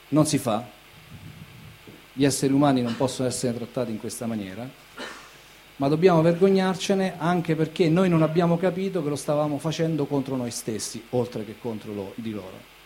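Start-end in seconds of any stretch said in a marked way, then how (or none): none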